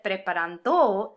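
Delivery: preparando wrongly stressed on the final "do"; misplaced stress. Shown as a demonstration. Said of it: "isn't a word".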